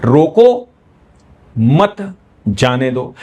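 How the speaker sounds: "रोको", long pause, then "मत", "जाने दो"; gap after रोको. Shown as a demonstration. Teacher demonstrating punctuation to students, long gap after "रोको". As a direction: leave a big gap straight after "रोको". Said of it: "इसके बाद आप गैप करिए".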